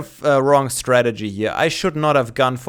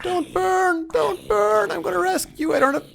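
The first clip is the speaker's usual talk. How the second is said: much higher, thin and pleading; 5 dB quieter than usual. Pretend. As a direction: beg it not to burn in a squeaky voice. Pleading with a burning villager.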